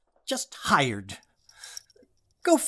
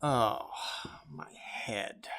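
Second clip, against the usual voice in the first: weary groan; 7 semitones lower, 13 dB quieter, much slower than usual. Acted. Tired husband groans.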